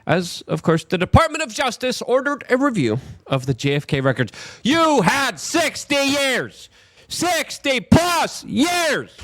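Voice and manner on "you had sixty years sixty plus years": outraged shout. Real, not acted. Outraged rant at delay.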